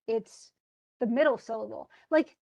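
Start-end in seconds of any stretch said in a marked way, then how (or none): none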